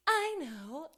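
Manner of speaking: gasp, then a high eureka; mock sudden realization. Retelling the heroine's big idea, mock-astonished. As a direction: act out a big fake lightbulb moment.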